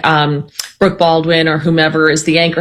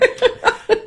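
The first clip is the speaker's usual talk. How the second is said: high, softer giggling; laughing; 5 dB quieter than usual. Real, not acted. Host still amused by co-host's pollster impression, giggling. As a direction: keep giggling after conceding the point.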